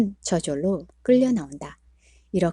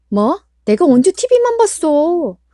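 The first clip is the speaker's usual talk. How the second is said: high, loud offended wife; indignant retort; 9 semitones higher, 7 dB louder, slower than usual. Acted. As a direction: fire it straight back at him. Wife snapping back at husband.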